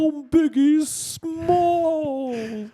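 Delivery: very high, hugely drawn-out name call; absurdly high, slow mock rapper. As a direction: hold the last word long in a squealed rapper parody. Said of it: "do in your [name] voice".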